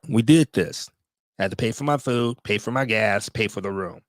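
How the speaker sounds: ordinary talk, nothing marked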